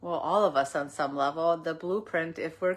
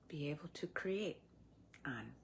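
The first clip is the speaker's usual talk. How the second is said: whispering; 14 dB quieter than usual. Real, not acted. Softly drops into a whisper.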